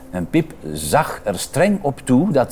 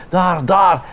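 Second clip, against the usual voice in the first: higher, louder excited call; small voice calling; 6 semitones higher, 3 dB louder than usual. Acted. Little child spotting Winter in the snow, excited call. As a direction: point and call out eagerly. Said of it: "riep een klein keeltje".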